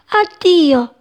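high little voices calling goodbye; little flowers crying farewell; tiny flowers' farewell. Acted. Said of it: "sussurrarono gli altri fiorellini". (vocine acute che salutano)

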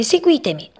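high, urgent command to follow; rally them to come along; urgent call. A narrator's reading. Agitated mouse leads frogs away.

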